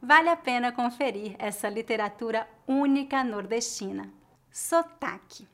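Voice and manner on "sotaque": ordinary delivery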